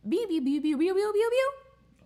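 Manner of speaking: rising beep beep sounds; beeping noise. Pretend. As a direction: beep like a machine, climbing. Imitated beeping.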